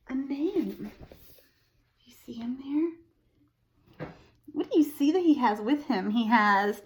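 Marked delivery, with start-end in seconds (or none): 2.0-4.1 hushed secret question; whisper. Real, not acted. Pointing at picture for kids.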